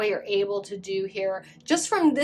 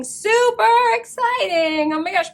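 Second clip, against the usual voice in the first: squealing excited; 11 semitones higher, 6 dB louder than usual. Real, not acted. Reader squealing "super exciting" about her masterclass, thrilled.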